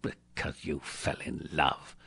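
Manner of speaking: low, quiet, contemptuous echo; growled. A narrator's reading. Scrooge scorning his nephew's love match, growling. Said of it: "growled Scrooge".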